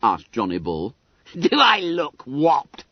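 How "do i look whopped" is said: high, slow schoolboy scoff; squeaky, drawn-out and loud mockery; derisive. A narrator's reading. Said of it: "asked Bunter derisively".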